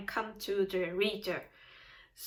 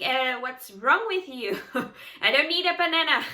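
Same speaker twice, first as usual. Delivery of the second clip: louder, faster, laughing mock rejection; laughing disbelief; 3 semitones higher, 4 dB louder than usual. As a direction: brush off the banana guy through laughter. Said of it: "You will also think".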